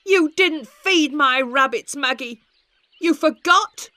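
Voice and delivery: put-on high, loud accusing boy; angry boy shout. A narrator's reading. Tom blaming Maggie for his rabbits, angry and loud. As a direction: blame her for the dead rabbits. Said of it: "Tom shouted".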